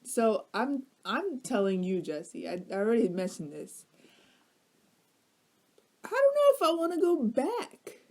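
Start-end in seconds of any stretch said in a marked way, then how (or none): 6.0-8.0 higher, whimpering fake sob; mock crying. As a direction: whine it like you're about to cry. Half-crying complaint about bar.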